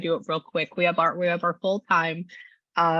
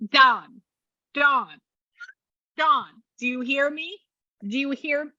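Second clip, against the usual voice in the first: higher-pitched teasing calls for attention; bugging someone playfully; 6 semitones higher than usual. Retold or imitated. Medium relays spirit pestering Dawn, playfully bugging. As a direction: call the name insistently, pestering in fun. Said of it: "All I hear is somebody going".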